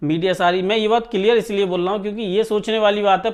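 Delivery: ordinary talk, nothing marked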